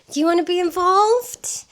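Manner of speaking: excited pet voice; high dog-talk voice. Real, not acted. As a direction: coo at the puppy to join in. Talking to her dog Kiwi.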